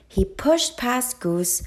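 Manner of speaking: plain reading voice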